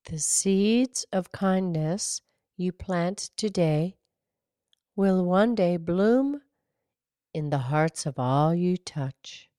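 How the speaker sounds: slow, measured poem recital; poem reading. Acted. Host reads Pinky a lovely poem about kindness, slow recital. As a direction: read the verse slowly, pausing after each line.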